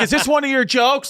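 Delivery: high, accusing mock outcry; retold yelling. Sister-in-law blaming the comedian for the wreath, yelling. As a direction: imitate an outraged relative accusing you. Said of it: "they were yelling at me".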